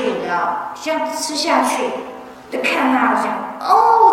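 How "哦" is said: high startled oh; loud, high startled "oh". Retold or imitated. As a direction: jump at the sudden sting. Startled by the sting.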